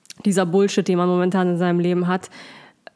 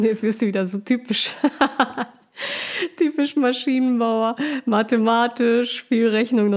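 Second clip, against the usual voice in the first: laughing, higher, teasing the engineer; teasing laughter; 4 semitones higher than usual. Real, not acted. Co-host laughingly teases her partner about his math. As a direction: giggle through a playful jab.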